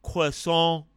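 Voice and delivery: croissant said the French way, kwa-SAWN, slow; hammed-up French pronunciation of croissant; mock French accent. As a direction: slowly put on a snooty French croissant. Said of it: "Us French Americans".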